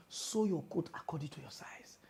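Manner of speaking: "Sew your coat according to your size" fısıltıyla, sessizce; whispered proverb. Shown as a demonstration. Proverb repeated as aside.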